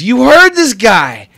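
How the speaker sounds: high slow shouted retort; loud insistence. Acted. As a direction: throw it back at her. GM voices Dell, turning.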